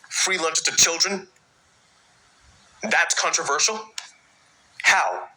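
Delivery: can't believe it's even debated; incredulous. Real, not acted. Incredulous rant about school lunches.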